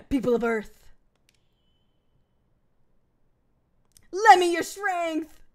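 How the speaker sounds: rally the planet like an anime hero; dramatic call. Pretend.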